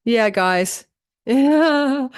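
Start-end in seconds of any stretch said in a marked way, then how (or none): 1.2-2.1 laughing it out, pitched up. Real, not acted. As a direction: say it through a real laugh. Laughs after clearing the screen.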